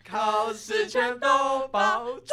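sung line that cracks on 炸; voice cracking. Shown as a demonstration. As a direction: let the voice break as you sing. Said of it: "我破音了". (唱到破音)

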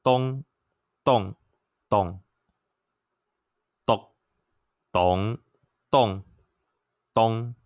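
东 动 咚 read through all eight Taiwanese tones, one by one; Taiwanese tone demo. Shown as a demonstration. Teacher showing the eight tones on 当, slow and careful. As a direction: step 东、动、咚 through each tone with pauses. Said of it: "是那八个声调哦".

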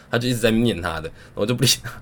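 ordinary delivery

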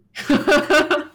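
short loud laugh; burst of laughter. Real, not acted. After joking about the drink order, loud laugh. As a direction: crack up at the punchline. Laughing at her own joke.

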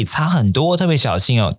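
ordinary delivery

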